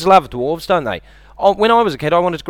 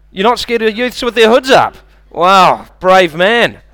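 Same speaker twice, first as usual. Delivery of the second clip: mock disbelief; 5 semitones higher, 6 dB louder, slower than usual. Teasing audience member.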